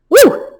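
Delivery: high, loud whoop of relief; sudden pitched woo; excited woo. Real, not acted. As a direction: whoop after a hard twister. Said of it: "This is a tongue twist and tail twister".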